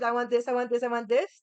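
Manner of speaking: mocking demands. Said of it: "Fucking snapping your fingers".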